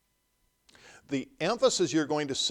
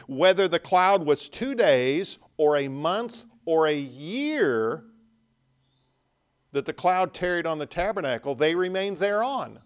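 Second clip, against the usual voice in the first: louder ringing scripture; 4 semitones higher, 6 dB louder than usual. Acted. Preacher proclaiming verse twenty-one, loud and ringing.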